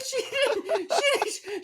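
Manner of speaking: high, slow words through real laughter; breaking up mid-sentence; helpless laughing. Real, not acted. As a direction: struggle to talk while cracking up. Cracking up while telling the story.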